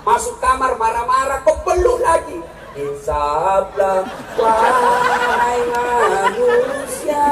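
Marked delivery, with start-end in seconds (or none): 2.8-7.2 very slow, drawn-out preachy intoning; mock preacher. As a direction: stretch every word like a solemn preacher. Mock sermon voice. (gaya penceramah yang sangat lambat, dibuat-buat)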